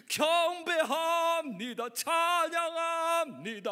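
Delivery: high, loud, drawn-out declarations; fervent adoration. Acted. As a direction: cry out praise with long vowels. Acted fervent worship cry.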